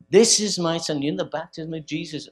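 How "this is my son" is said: loud proclaiming declaration; voice from heaven. Retold or imitated. Preacher voicing heaven's call to the crowd, loud. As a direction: announce it to the whole crowd. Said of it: "that voice from heaven came".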